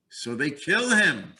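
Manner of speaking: ordinary delivery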